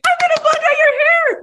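very high, sustained excited squeal; over-the-top imitation of a thrilled founder; ecstatic pitch. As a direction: sing out the offer like thrilling news. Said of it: "But no, it wasn't that".

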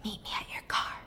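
urgent whisper to his brother; whispering a secret meeting point. Retold or imitated. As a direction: breathe it out with no voice, tense. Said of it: "Connie whispers at him".

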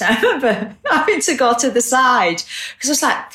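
laughing through the memory. Real, not acted. Friend recalling a concert to her co-host, laughing. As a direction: chuckle while recalling.

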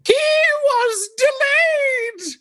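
very high, slow panicked shriek; drawn-out falsetto screech, grandly proclaiming; Monty Python old lady. Acted. Player in Fluffy's screeching voice.

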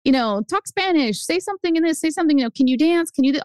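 high, mock-taunting kid voice; needling singsong demands; older kids teasing. Older kids taunting a little girl, high mock-teasing voice. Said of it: "the older kids would be like".

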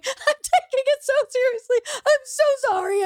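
very high, tearful squeak; squeaky, half-crying protest of seriousness; retold giggling apology. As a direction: mimic pleading through uncontrollable laughter. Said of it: "And I was going".

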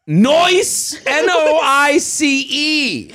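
high, hammed-up mock-cool slang; high goofy imitation of the texter; wannabe-cool dad. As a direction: stretch the word, then spell it out with goofy relish. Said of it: "Okay, here's him".

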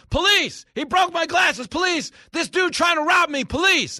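high, loud mock shouting for police; yelling for police. Host playing a con man shouting for the police at a tourist. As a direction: holler like a scammer making a scene. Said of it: "just start yelling".

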